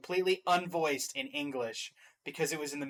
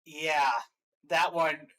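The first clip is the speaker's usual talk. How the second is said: ordinary talk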